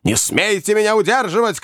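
high-pitched indignant protest; angry shouting. A narrator's reading. Old man being held back by friends, angry shouting. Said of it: "кричал старик".

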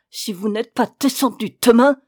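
loud, angry officer's ultimatum; enraged threat. A narrator's reading. Raging officer giving the silent woman a last warning, angry. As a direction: threaten loudly as a fuming officer. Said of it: "Il rageait".

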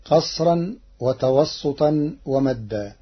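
usual speaking voice

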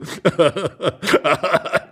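rolling, helpless chortle; old man's guffaw. Acted. Husband bursting to share his guess, laughing. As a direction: laugh before you can answer.

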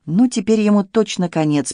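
usual speaking voice

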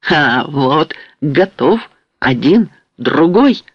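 ordinary delivery, nothing marked

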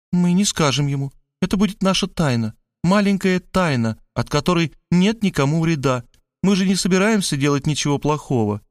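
lightly voiced persuasive character; conspiring Maria. A narrator's reading. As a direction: coax him into a little secret. Maria proposes a secret.

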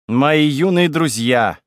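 slow, loud, theatrical ghostly greeting; otherworldly voice. A narrator's reading. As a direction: call out like a spirit from beyond. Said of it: "потусторонний голос".

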